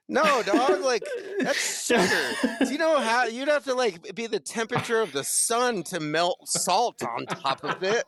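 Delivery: high, laughing disbelief; can't believe he thinks sugar is salt; incredulous. Retold or imitated. Incredulous at server's salt mix-up.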